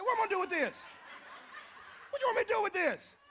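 high, loud incredulous laughing protest; mock outrage. Customer to waitress about wrong pancakes, hammed-up loud protest.

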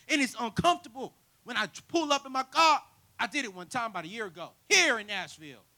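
loud, high, impassioned sermon delivery; full-force preaching. Real, not acted. Preacher warning the church about comfort zones, full-force preaching. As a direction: drive the point home to the congregation.

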